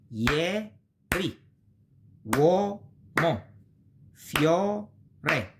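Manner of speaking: "Ieri", "Uomo", "Fiore" pulled apart into syllables; teacher demonstrates the syllable division; syllable-divided Italian. Shown as a demonstration. Teacher showing beginners how these words split, drawn out.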